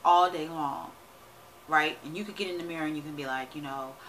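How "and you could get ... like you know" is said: normal talking voice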